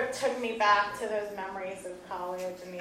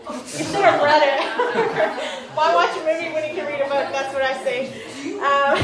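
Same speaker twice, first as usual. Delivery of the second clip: teasing laugh; 5 semitones higher, 9 dB louder than usual. Real, not acted. Teasing the audience.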